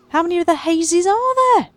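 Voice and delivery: excited. Real, not acted. Spotting neighbourhood party.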